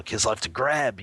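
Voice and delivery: normal talking voice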